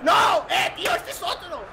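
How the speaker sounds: high frantic shouts; panicked yelling. Real, not acted. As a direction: yell in panic as an enemy appears. Ambushed by another player.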